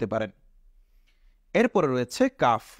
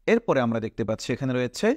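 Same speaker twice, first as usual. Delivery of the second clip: ordinary talk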